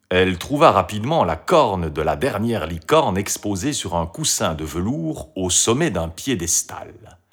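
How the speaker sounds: plain reading voice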